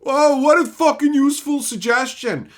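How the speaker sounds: usual speaking voice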